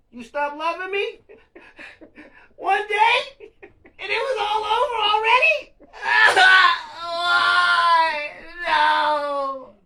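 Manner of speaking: high, loud laughing fake cry; mock wail through laughter. Retelling his own tantrum to viewers, laughing wail. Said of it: "it was like".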